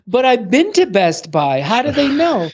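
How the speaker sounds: put-on puzzled elderly client. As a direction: pitch up into her puzzled question. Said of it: "And she's saying".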